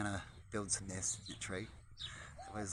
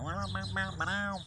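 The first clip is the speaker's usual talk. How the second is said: pigeon voice; 8 semitones higher, 5 dB louder than usual. Pretend. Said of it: "We go".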